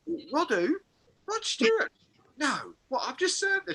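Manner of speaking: hammed-up dawning surprise; mock disbelief. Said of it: "acted shop worker realising".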